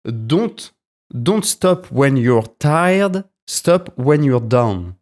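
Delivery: slow, deliberate English with a French accent. Real, not acted. Podcaster quotes a motivational line to listeners, slow French-accented English. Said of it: "désolé pour mon accent anglais".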